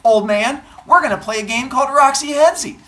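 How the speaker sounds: put-on high, loud kids' retort; cheeky kids. Kids answering an old man, high and loud. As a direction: play kids loudly announcing their game. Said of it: "They're like".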